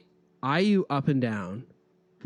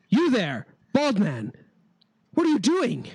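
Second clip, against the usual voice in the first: lightly voiced guard, raised and challenging; 8 semitones higher than usual. Guard halting a bald stranger at the gate, challenging. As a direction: call out a higher-pitched challenge as a guard. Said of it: "but they say".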